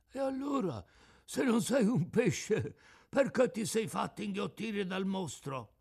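very high, out-of-tune fish voice; cracked tuna voice. Acted.

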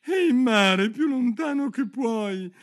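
high shout, loudest at the start; calling out. A narrator's reading. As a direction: fling the words far out to sea. Turtle shouting dance instructions. (grido acuto, lanciato lontano)

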